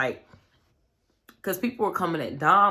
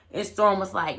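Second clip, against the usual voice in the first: ordinary talk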